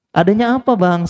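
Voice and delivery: high, smiling ask to the vendor; smiling question. Retold or imitated. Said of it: "sambil senyum".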